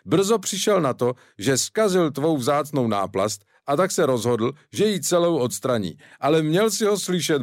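ordinary delivery

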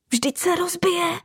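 high alarmed exclamation; alarmed cry. Acted. Ornaments crying out as she falls, alarmed. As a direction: gasp in fear.

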